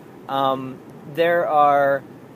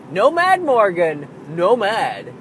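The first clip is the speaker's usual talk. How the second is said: a light mock toddler, higher on the first no mad; sing-song it like a small child; toddler pleading; 5 semitones higher, 5 dB louder, slower than usual. Daughter begging her dad not to be mad, mock toddler voice. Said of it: "which is her way of saying".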